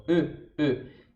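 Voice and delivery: "E" with lips puckered, like eu; short rounded "E" in a pout; French pouted E. Shown as a demonstration. Teacher showing the French pout, rounded E. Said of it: "o tal do biquinho do francês".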